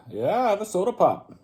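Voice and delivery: put-on very high, loud Minnesota accent; hammed-up drunk Minnesotan accent. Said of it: "Sounds like a drunk guy from Minnesota".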